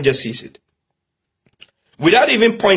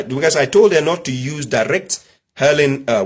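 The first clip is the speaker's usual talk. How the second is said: normal talking voice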